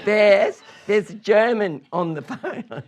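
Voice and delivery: high, loud call broken by laughter; laughing mock mum voice; Bill's mum again, laughing. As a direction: laugh through the mum's call. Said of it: "He was French".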